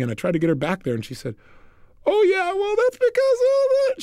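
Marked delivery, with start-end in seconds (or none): 2.1-4.0 very high, drawn-out tearful wail; mock crying. Woman reminded that she was crying before, mock crying. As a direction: imitate her slipping back into sobs. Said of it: "She started crying again".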